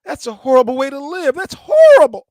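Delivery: high, loud, near-crying imitation; high-pitched mock tearful girlfriend; mock upset girlfriend. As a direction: whine it like she's about to cry. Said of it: "one girl told me".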